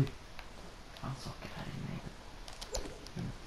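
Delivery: hushed, unvoiced repeat; whispered aside. Real, not acted. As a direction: whisper it so nobody hears.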